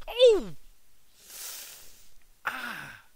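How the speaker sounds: high loud yelp; Henry crying out when Peter kicks him; cry of pain. A narrator's reading. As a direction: yelp from a kick.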